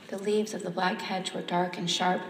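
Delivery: plain reading voice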